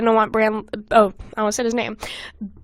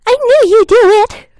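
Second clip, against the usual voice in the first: put-on much higher and louder eerie child, laughter at the edge; creepy young voice; 11 semitones higher, 10 dB louder than usual. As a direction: push it up into a shrill-free high childish taunt. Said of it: "I'm gonna do it again".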